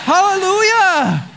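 high, slow, loud gringo "Hallelujah"; mock hallelujah. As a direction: throw in a proud little "Hallelujah". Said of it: "throwing my little bueno".

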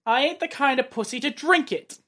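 put-on British accent, tough talk; British accent line. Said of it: "Just lines like that".

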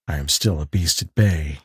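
low and soft, a hunted man's threat; low, hoarse voice. A narrator's reading. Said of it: "in a low, hoarse voice".

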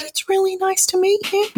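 very high, small put-on voice; client Bill's voice. Client Bill greeting his new voice coach, high falsetto imitation. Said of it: "And he said".

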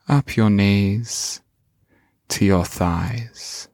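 slow, calm meditation voice; guided meditation. Acted. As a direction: guide the listener gently inward.

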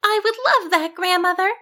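high, sweet sheep voice; high-pitched, polite character voice; agreeable sheep heroine. Acted. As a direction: accept eagerly in a high little voice. Sheep heroine answers grandmother.

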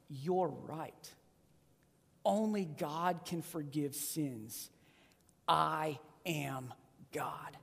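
soft, slow and low; calm declaration. Retold or imitated. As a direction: state it quietly with full weight. Jesus's calm answer voiced.

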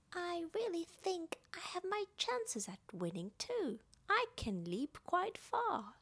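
high-pitched, eager little character voice; high, lively storybook youngster; Roo. A narrator's reading. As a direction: pipe up high and hopeful. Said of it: "said Roo".

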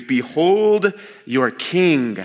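slow, theatrical mockery; mocking. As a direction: present him mockingly, stretching the words slowly. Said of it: "he says this mockingly".

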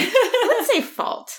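drawn-out high laughing protest. Real, not acted. Co-host laughing while correcting about the baby, slow and high.

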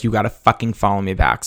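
normal talking voice

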